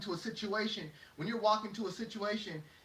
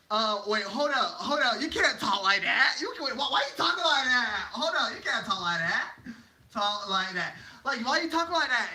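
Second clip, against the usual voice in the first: loud, higher, stretched-out protest; mock outraged objection; 3 semitones higher, 5 dB louder than usual. Critics scolding someone for how they talk, mock outrage. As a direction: cut in, aghast, piling up complaints.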